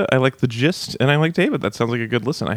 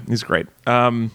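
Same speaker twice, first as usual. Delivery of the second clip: ordinary talk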